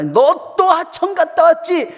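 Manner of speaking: acted-out mother telling off her child; angry mother. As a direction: scold like an exasperated mom. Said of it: "막 화를 내시는 거예요".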